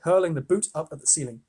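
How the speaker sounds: plain reading voice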